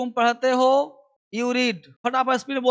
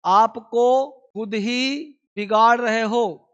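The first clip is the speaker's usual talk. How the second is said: slow dictation; much slower than usual. Shown as a demonstration. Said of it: "लिख रहा हूं हिंदी".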